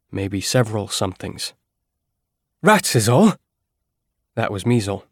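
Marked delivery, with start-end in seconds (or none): 2.6-3.3 higher, louder, puffed-up know-it-all; trying to sound authoritative. Acted. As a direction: bluff a confident dismissal. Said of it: "He was trying to sound authoritative".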